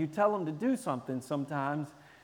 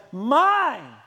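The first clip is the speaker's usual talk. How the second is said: put-on loud, higher childish shout; snatch the toy and claim it; grabby kid; 6 semitones higher, 9 dB louder than usual. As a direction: selfish toddler yelling. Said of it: "they grab their toys and they say".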